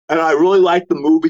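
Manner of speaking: gentle imitation of effortful, slurred speech; imitating Tommy. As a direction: respectfully mimic a friend struggling to talk. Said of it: "I'm just saying how he goes".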